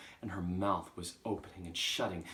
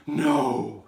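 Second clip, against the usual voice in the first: loud, raised, gasping denial; horrified cry; 6 semitones higher, 16 dB louder than usual. Acted. Trunchbull reading Magnus's name, horrified cry. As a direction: cry out in shock. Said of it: "she cried".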